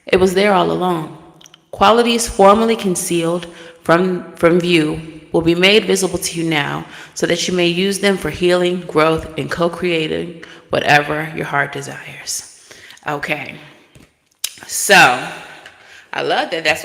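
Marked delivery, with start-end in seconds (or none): 0.1-9.4 grand, loud inspirational read; oracle card reading. Acted. Card message read continues. 9.4-12.7 whispering. Acted. Whispered end of message.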